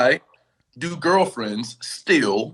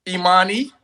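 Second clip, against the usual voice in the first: scolding by full name; 4 semitones higher than usual. Acted. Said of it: "He said your full name".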